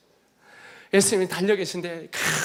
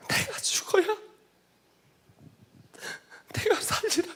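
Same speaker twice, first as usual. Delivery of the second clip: higher, crying through the words; tearful repeat; 4 dB quieter than usual. Retold or imitated. Preacher repeating Jesus' answer, now crying. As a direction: say it again as tears take over. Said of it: "이렇게 말씀하셨어요".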